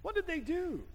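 ordinary delivery

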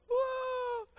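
high yelped whoa as the plane spins; startled whoa. Retold or imitated. Pilot yelping as the plane spins, startled. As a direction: yelp it out in sudden panic.

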